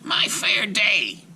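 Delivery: high, slow proud witch; with great pride. A narrator's reading. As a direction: savour it like a proud old hag. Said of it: "said with great pride".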